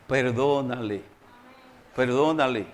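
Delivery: low, soft, measured urging; gentle command. Acted. Preacher voicing the Lord's command to forgive, gently and low. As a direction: drop low and quietly urge forgiveness.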